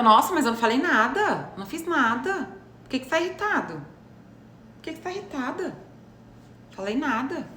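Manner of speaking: high-pitched mock-innocent denial; passivo-agressiva. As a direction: play innocent while needling them. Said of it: "fica com raiva". (falsa inocência passivo-agressiva, voz aguda)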